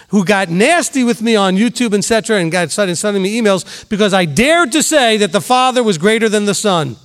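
usual speaking voice

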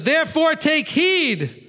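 high, slow and ringing; loud call. Retold or imitated. Preacher voicing Yeshua warning the crowd loudly. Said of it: "he was getting loud".

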